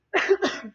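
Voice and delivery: a leftover cough from choking on water. Real, not acted. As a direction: clear the throat with a rough cough. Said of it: "Water went down the wrong pipe".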